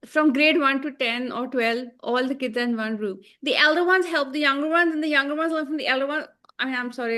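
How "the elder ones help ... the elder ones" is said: a hint of a put-on American accent, rushing at the end; bad American accent attempt. Mocking the show's folksy line about the one-room school. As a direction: try an American accent and half fail. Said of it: "I can't do the accent".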